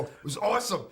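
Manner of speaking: high, loud gushing 'awesome'; mock gushing praise. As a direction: gush like a fan who loved it. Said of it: "they don't go".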